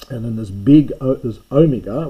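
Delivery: omega said out clearly for students; demonstrating letter name. Shown as a demonstration. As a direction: sound out omega as a model. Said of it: "which is big O".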